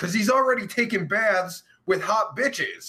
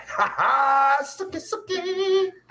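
high, slow put-on squeaky taunt; cartoonish high-pitched teasing voice; mock taunt; 10 semitones higher, slower than usual. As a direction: sing it out like a cartoon tease. Mocking taunt on stream.

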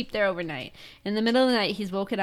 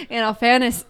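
louder imitated Irish accent, laughing; 3 semitones higher, 7 dB louder than usual. Irish guy repeating himself to her, imitated Irish accent. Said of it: "And he was like".

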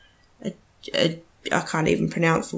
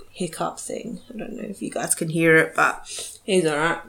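ordinary talk, nothing marked

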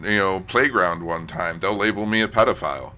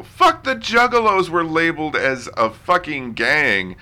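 exasperated outburst; 8 semitones higher, 6 dB louder than usual. Real, not acted. Outraged rant about Juggalos.

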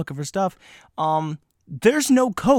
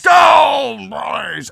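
mock touchdown shout; 10 semitones higher, 10 dB louder, much slower than usual. Mock sports cheer.

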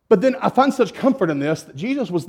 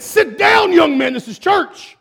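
raised, high-pitched rebuke; mock scolding; 9 semitones higher, 3 dB louder, slower than usual. Church people to young man interrupting service, mock scolding. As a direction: order him down as an indignant congregation.

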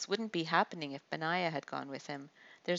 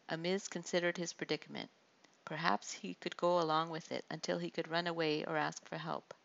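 ordinary delivery, nothing marked

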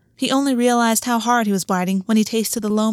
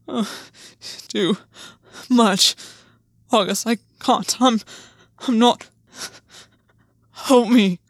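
desperate pleading; slower than usual. Acted. Overwhelmed lover pleading, breaking.

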